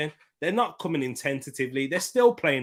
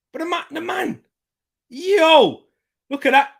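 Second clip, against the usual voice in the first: high, loud amazed call ending in a laugh; excited laughing disbelief; 9 dB louder than usual. Real, not acted. Fan watching the keeper misjudge a ball, high amazed laugh.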